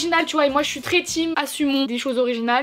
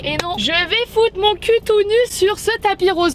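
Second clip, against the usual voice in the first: determined; 6 semitones higher, 3 dB louder than usual. Real, not acted. Defiant vow to reach red carpet.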